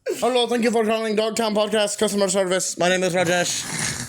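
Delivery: hammed-up Indian call-center greeting, half sung over music; mock Indian accent. Fake call-center agent Rajesh greets podcast callers in a mock Indian accent. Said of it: "do the accent".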